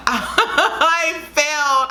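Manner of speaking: slow, high laugh through the words. Real, not acted. Tell the viewers you failed again, laughing ruefully. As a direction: laugh at your own failure. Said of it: "I'm laughing now".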